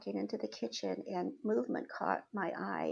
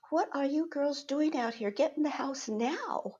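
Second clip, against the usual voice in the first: higher-pitched scolding, slowing onto the last word; stern adult; 5 semitones higher, 4 dB louder than usual. Retold or imitated. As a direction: order the children indoors like the adult in charge. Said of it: "being the adult, I went in".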